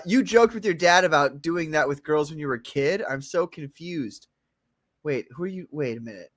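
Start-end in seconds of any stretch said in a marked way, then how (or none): none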